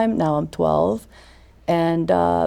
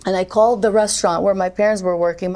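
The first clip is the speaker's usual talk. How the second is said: ordinary talk, nothing marked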